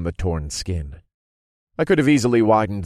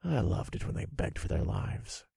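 whispered; 6 semitones higher, 11 dB quieter than usual. A narrator's reading. Narrator relishes begging, whispered.

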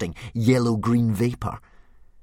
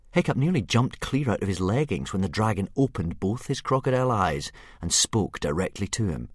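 plain reading voice

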